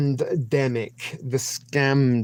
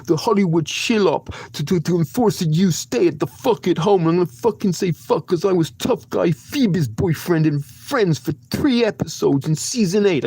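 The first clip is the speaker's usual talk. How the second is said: loud New York tough guy, higher; New York tough guy accent; 6 semitones higher, 5 dB louder than usual. Acted. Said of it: "in a New York accent".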